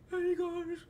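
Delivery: airy, very high ghost voice; high-pitched cartoon ghost; High Five Ghost. Shown as a demonstration. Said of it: "It's kinda airy".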